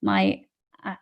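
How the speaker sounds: usual speaking voice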